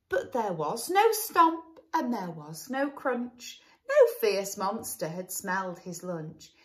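put-on sing-song read-aloud for children; lilting rhyme for a little listener; storybook narration. A narrator's reading. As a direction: read it like a bedtime picture book.

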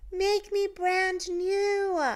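slow little puddle voice. A narrator's reading. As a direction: call up from the puddle, drawn out.

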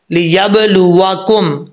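लियबलुवकुम slowly, lips rounded on the w; rounded-lip waw. Shown as a demonstration. Teacher showing how waw is made, slow rounded articulation. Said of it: "होंठ गोल करेंगे".

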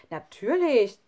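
a loud, sure Natürlich. Acted. Lukas answering Sami about God, loud and certain. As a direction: blurt it out loudly, no doubt at all. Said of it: "sagte Lukas so laut".

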